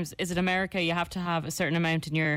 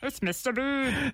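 slow, throaty put-on voice, pitched higher; Mister Bean; 5 semitones higher, slower than usual. Co-host showing their only impression, slow throaty character voice. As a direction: let the throat collapse into a comic character. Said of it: "I keep putting on my Mister Bean voice".

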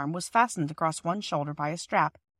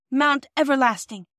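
breathless; 6 semitones higher, 5 dB louder than usual. Acted. Phyllis tired on climb.

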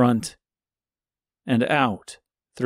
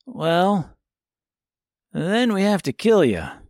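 higher, breezy offhand threat; nonchalant; 7 semitones higher than usual. A narrator's reading. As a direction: toss it off casually. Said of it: "replied Tip nonchalantly".

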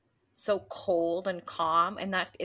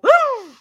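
loud dramatic gasp; 16 dB louder than usual. Retold or imitated. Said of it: "And they go".

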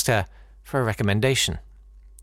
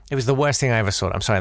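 ordinary talk

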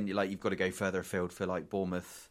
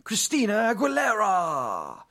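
much higher, louder, slow fight announcer call; ring announcer; 12 semitones higher, 8 dB louder, slower than usual. Acted. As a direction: hype up the red corner like a boxing MC.

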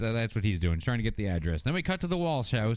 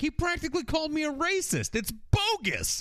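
whiny Brandon. Retold or imitated. Brandon griping to Brenda in the car, hammed-up whine. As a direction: ham up a sulky teen complaining. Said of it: "he's like".

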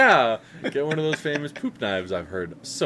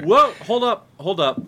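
much higher, loud whoa; startled objection; 8 semitones higher, 7 dB louder than usual. Real, not acted. Co-host cutting off the host's accusation, loud startled whoa. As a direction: stop him short in alarm.

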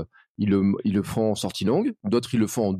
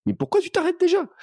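mock exasperation. Listener running in the morning, protesting that the podcast ends, in high mock outrage. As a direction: squeal it like you can't believe the episode stopped. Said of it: "et je dis".

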